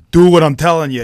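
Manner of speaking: loud, forceful gangster order; hothead command. Retold or imitated. Actor imitating his character ordering Billy around, loud and forceful. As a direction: bark the order like a boss who won't be crossed. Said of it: "but then when it drops down".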